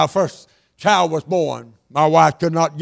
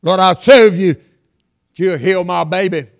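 slow, loud pleading prayer; slow loud plea; 7 dB louder, slower than usual. Acted. Preacher voicing a parent begging God to heal a baby, slow and loud.

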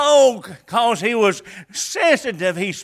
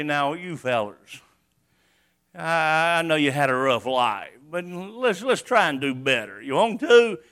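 mock gentle coaxing; 5 semitones lower, 4 dB quieter than usual. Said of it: "He didn't say".

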